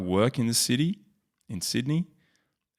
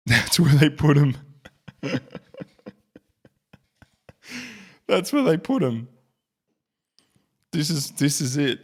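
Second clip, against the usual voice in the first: laughing; 7 semitones higher, 4 dB louder than usual. Real, not acted. Laughing at the tiny base.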